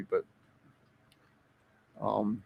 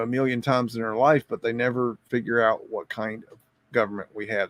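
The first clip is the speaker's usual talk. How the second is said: normal talking voice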